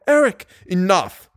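crying out. A narrator's reading. Captive begs Eric to stop, crying out. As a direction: cry out to stop him. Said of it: "I was crying out in concert with Christine".

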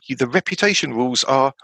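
ordinary talk, nothing marked

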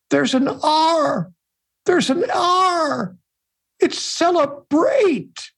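high, slow, sobbing lament; grief-stricken Pope realizing the mistake; crying. A narrator's reading. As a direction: wail each word out slowly. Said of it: "and he starts to cry".

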